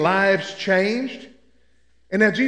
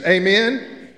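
ordinary delivery

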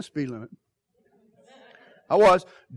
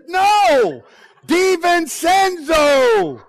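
higher, louder, name stretched out slowly; exasperated shout; 8 semitones higher, 6 dB louder, much slower than usual. Retold or imitated. Husband correcting the receptionist on the phone, slow exasperated shout. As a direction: shout the name so it finally lands.

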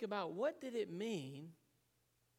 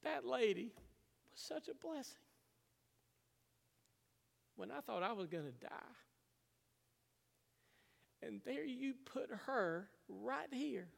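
fighting tears, speaking through emotion; choked up; 6 semitones higher than usual. Real, not acted. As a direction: voice rising high and thin with tears held back. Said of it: "I'm gonna cry on y'all".

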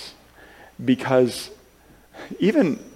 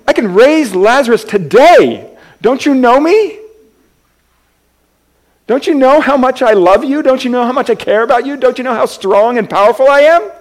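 much higher and louder, pleading and passionate; impassioned Jesus; 11 semitones higher, 9 dB louder than usual. Retold or imitated. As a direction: cry it out as Jesus to his grieving friends. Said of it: "And Jesus says".

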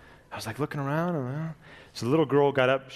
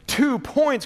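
high, louder desperate wail; he's losing hope fast; desperate cry; 13 semitones higher, 6 dB louder than usual. Acted. Desperate man crying out to Gabriel, high and loud. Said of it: "cried the man in desperation".

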